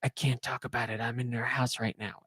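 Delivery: hushed, furtive phone voice; imitated low-volume confiding on the phone; talking very quietly. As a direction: keep it down so the homeowner won't hear. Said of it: "like, very quietly talking".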